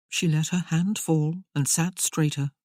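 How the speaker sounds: ordinary delivery, nothing marked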